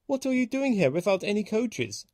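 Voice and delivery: can't believe his eyes; startled signalman. A narrator's reading. Signalman asks Thomas about missing coaches, astonished and high. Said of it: "he asked".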